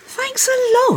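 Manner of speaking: high, loud sarcastic protest; indignant. Acted. Wife whose romantic moment is spoiled, indignant. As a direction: snap back, pitched right up. Mood spoiled by remembered book.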